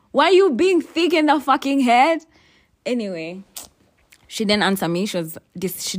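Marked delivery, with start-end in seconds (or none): 0.1-2.3 loud, high exasperated yell at her; retold yell. Yelling at Natasha for dodging her questions, loud and high. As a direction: shout the insult in full outrage. Yelling at a girl who lied.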